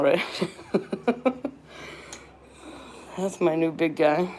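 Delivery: ordinary delivery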